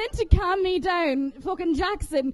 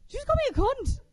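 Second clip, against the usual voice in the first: indignant; 7 semitones higher, much faster than usual. Real, not acted. Indignant retort to a heckle.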